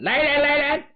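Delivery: repeated high call for guards; bully yelling for his men again; high shout. Acted. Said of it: "吴胖子高声喊".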